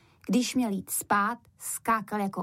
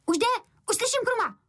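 put-on high-pitched excited boy's cry; shouting boy; 13 semitones higher, faster than usual. A narrator's reading. Boy at the door crying that Krum is coming, high shout. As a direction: call out in a high child's shout. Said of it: "poslouchal u nich a křičel".

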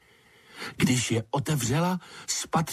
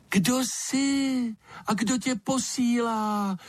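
slow, high chick-like peep; thin and piping yet cutting, drawn out slowly; thin little voice; 12 semitones higher than usual. A narrator's reading. Unseen creature questioning an intruder in the dark, thin little voice. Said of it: "hlásek tenký jako pípot ptačího mláděte".

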